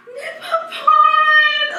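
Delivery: high, drawn-out cry of the name, laughing; tearful joyful cry. Retold or imitated. Old friend hearing from a lost refugee child, overjoyed high cry. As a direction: call the name out in joyful disbelief, near tears. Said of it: "her voice was shivering, she was crying".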